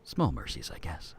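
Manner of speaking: soft, low and quiet. Acted. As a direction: let it fall to near silence. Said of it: "but it's so soft".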